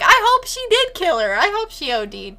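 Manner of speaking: mock vindictive. Speaker voices herself in the story.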